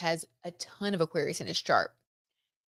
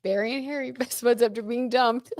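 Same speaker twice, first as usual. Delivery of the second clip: laughing; 5 semitones higher than usual. Real, not acted. Host rereading the joke to viewers, giggling through it. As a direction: read it again as the giggle takes over.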